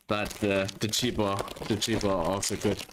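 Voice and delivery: eating sour candy while talking; talking through crunchy candy; crunching candy. Real, not acted. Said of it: "for the crunching noise".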